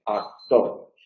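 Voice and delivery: actor with the k softened toward a D; voiced relaxed k. Shown as a demonstration. Phonetics teacher modelling the relaxed voiced k. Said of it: "es una especie de D".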